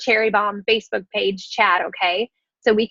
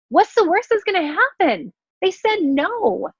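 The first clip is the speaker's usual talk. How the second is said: mock alarm; 8 semitones higher than usual. Mock dread then deflating answer.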